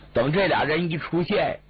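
ordinary delivery, nothing marked

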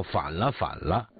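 plain reading voice

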